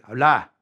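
"habla" stressed on the last a; final-syllable stress. Shown as a demonstration. Teacher giving the vos command to speak, stress on the end of "habla". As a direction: push the weight onto the final a of "habla". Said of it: "accent on the last vowel there".

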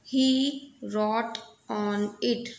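very slow, word by word; slow reading aloud. Shown as a demonstration. Teacher reading the story line to students, very slow. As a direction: read it slowly so the class follows. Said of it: "reading aloud to class".

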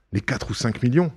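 ordinary delivery

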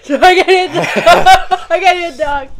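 laughing through the words; cracking up mid sentence. Real, not acted. Laughing fit while reading sponsor.

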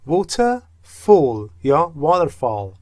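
واترفال said the American way, with a flapped t like "vader-fall"; American accent. Shown as a demonstration. (لهجهٔ آمریکایی برای واترفال)

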